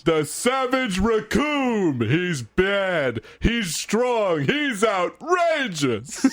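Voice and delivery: slow booming muscle-brute announcer; Recoome voice. Pretend. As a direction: shout the brute's titles slowly and proudly. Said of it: "Read it in your dumb Recoome voice".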